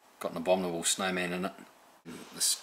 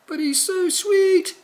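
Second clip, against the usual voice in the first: very high, loud, slow gushing coo; goofy witch pleading for the dragon, very high gushing; doting witch; 11 dB louder, much slower than usual. Retold or imitated. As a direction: gush over the baby dragon. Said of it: "says the witch".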